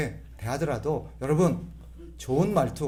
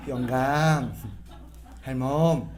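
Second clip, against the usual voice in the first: "영감, 할멈" said lower and gently; showing the kind way to call your spouse, gently; gentle call; 3 semitones lower, much slower than usual. Shown as a demonstration. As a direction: call them tenderly. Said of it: "이렇게 불러".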